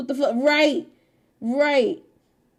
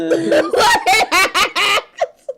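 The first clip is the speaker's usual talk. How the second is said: laughing out loud, pitched up; loud high laughter; 8 semitones higher, 8 dB louder than usual. Real, not acted. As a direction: let a big high laugh go. Laughs at condolences slip.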